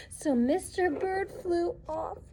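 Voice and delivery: ordinary delivery, nothing marked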